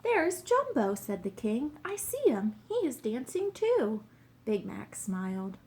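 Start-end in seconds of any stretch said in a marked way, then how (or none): 0.0-1.0 high, joyful cry of recognition; delighted excitement. A narrator's reading. King spots his missing elephant, excited. 1.9-4.0 high-pitched, happy exclaiming; delighted excitement. A narrator's reading. King watches his found elephant dance, delighted.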